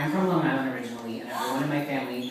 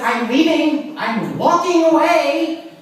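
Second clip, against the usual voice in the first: higher and louder, slow huffy threat; mock huffy exit; 11 semitones higher, 9 dB louder, slower than usual. Woman loudly threatening to walk away from mother. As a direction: storm off in a loud huff.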